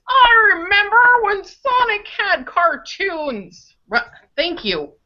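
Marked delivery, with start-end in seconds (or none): 0.1-3.7 put-on slow, high, quavering old man voice. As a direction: crackle it out like a grandpa reminiscing. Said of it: "in an old man voice".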